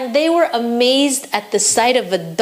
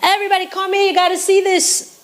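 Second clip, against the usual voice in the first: pitched way up, calling out across the market; excited scream; 7 semitones higher than usual. Acted. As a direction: yell for everyone to come and look. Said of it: "he started to scream".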